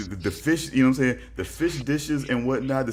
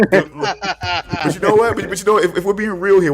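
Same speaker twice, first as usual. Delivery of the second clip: high, loud laughing through words; 7 semitones higher, 7 dB louder than usual. Real, not acted. Host answering about Hannibal's table, laughing loud. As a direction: laugh while you start talking.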